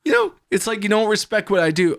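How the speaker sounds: high, loud hurt dude voice; mock wounded friend. Hurt friend complaining about his home, high and aggrieved. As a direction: whine about being disrespected.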